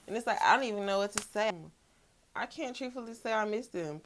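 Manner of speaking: usual speaking voice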